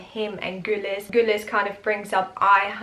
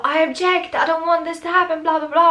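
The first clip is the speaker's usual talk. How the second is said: high mock protest, higher; mock outraged mother-in-law; 7 semitones higher, faster than usual. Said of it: "Mama David is all".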